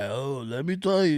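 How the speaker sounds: a lightly voiced old lecturing agent; mocking the old agent lecturing him, barely changed voice; mock barking agent. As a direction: mimic a self-important old guard. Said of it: "You start barking".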